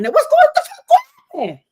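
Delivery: high squeal breaking into laughter; laugh-shriek in shock; high laughing outrage; laughing disbelief. Real, not acted.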